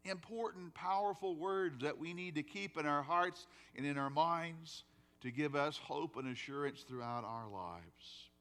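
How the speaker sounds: normal talking voice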